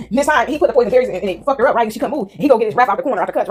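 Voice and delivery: ordinary delivery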